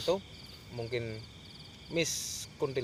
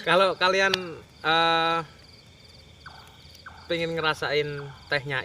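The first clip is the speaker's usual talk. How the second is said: calling out; 5 semitones higher, 6 dB louder than usual. Real, not acted. Calling out to viewers.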